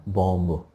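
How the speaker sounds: बॉम्ब with the final b sounded; बॉम्ब ending in an audible b; common mispronunciation. Shown as a demonstration. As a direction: say बॉम्ब as bom-bo. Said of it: "कई लोग से सुना होगा ना".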